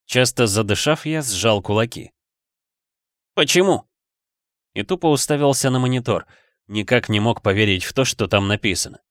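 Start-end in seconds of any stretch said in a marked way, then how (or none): 3.3-3.8 angry cry. A narrator's reading. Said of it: "stunned gamer shouting at the screen".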